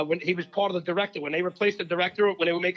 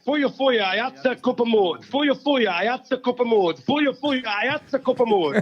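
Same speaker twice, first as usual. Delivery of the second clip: higher, louder rush through the chant; louder racing chant; fast tongue twister; 6 semitones higher, 4 dB louder, slower than usual. Shown as a demonstration. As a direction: rattle it off three times, pushing hard. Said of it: "Try it three times quickly".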